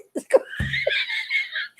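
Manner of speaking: high burst of real laughter. Real, not acted. Laughing at the joke.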